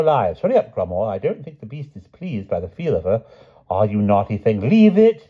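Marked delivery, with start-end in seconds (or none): 4.7-5.3 sharp high loud command to a dog; scolding shout. A narrator's reading. The king to the beast, loud scolding order.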